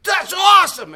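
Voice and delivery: very high, loud whoop of praise; excited cheering dad. Retold or imitated. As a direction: shout it like a thrilled parent. Cheering daughter at the slide.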